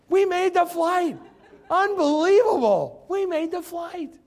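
high, loud and slowed-down triumph; excited exclaim. Real, not acted. Preacher telling the congregation they made the flight, high and elated. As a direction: savor the victory, almost singing it. Preacher exulting over answered prayer.

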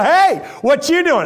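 high, loud, cheery hello; excited bragging call. Retold or imitated. Calling buddies back home from the beach, cheery bragging. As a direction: rub it in with a bright call.

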